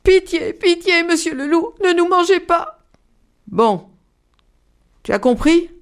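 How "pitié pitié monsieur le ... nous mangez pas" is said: high, begging rabbit voices; higher, pleading plea; pleading little rabbits. A narrator's reading. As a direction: beg the wolf for mercy. Rabbits beg the wolf.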